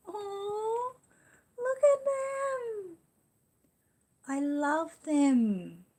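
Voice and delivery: very high, slow, doting coo. Real, not acted. Gushing over a viewer's twin baby photos, high slow coo. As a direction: melt over the tiny babies.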